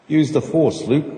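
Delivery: film quote voice. Shown as a demonstration. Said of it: "that's, uh, another one".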